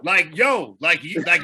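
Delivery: ordinary delivery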